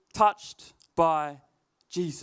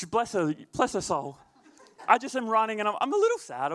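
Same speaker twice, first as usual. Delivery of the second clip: normal talking voice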